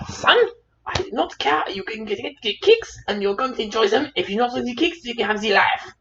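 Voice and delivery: high-pitched mum's ultimatum; emphatic, high motherly telling-off; stern mother. Retold or imitated. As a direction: scold a son in a mock mother's voice. Voices mother's angry ultimatum.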